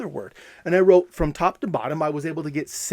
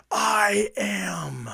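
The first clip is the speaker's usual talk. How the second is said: whisper-shout; 5 semitones higher than usual. Shown as a demonstration. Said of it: "and I would say".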